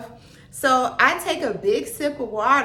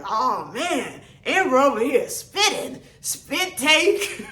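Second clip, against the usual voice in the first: laughing instructor; 3 semitones higher, slower than usual. Retold or imitated. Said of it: "He was like".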